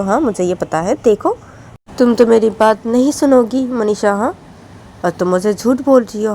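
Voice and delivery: angry scolding mother. Acted. Mother scolds crying daughter.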